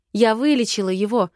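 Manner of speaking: ordinary delivery, nothing marked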